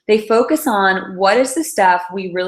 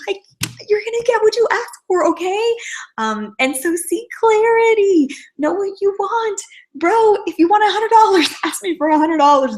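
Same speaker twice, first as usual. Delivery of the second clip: high, sassy put-on indignation; hammed-up outrage with a giggle; mock indignant rant; 12 semitones higher than usual. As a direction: scold him playfully, pitched way up.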